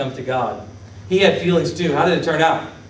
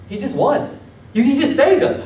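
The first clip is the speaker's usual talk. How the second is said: incredulous protest; 8 semitones higher than usual. Retold or imitated. People protesting Saul's sentence, retold.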